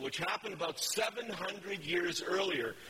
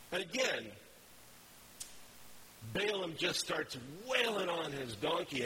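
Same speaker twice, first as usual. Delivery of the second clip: normal talking voice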